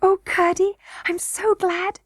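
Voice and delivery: put-on high little-girl relief; young princess Irene, glad. A narrator's reading. Princess glad the boy is freed, high-voiced.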